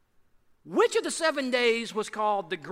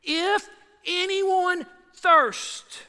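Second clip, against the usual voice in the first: drawn-out call, pitched higher; slow dramatic cry, higher still; 8 semitones higher, 5 dB louder, much slower than usual. Acted. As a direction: cry it out slow and high to the whole crowd. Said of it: "That's dramatic".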